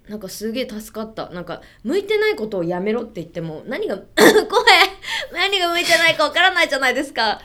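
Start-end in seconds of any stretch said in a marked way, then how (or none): 4.3-5.3 short rising laugh; bright laugh. Real, not acted. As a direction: burst into a laugh. Laughs mid sentence.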